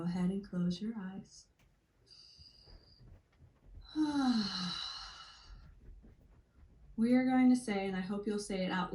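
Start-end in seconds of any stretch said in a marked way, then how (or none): 3.7-5.2 long sighing exhale, falling away. Shown as a demonstration. Guide breathing along as listeners close their eyes, long sighing exhale. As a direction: breathe out fully with an audible "ah".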